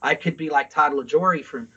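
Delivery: normal talking voice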